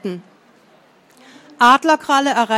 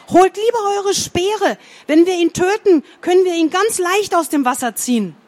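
reckless excitement; 7 semitones higher than usual. A narrator's reading. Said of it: "trat der Übermut in seine Augen".

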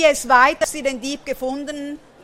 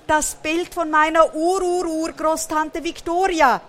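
higher-pitched theatrical lament; plaintive storybook character voice; wailing; 6 semitones higher, 4 dB louder than usual. A narrator's reading. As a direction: moan over a precious loss. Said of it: "jammert Elvis".

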